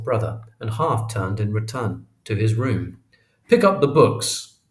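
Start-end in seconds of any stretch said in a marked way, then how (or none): 3.5-4.6 stern father, higher and louder; stern command. A narrator's reading. Father commanding the children after the crash, stern voice. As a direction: give a firm, raised-voice order. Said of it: "commanded finally in a stern voice".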